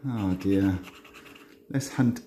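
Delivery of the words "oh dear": normal talking voice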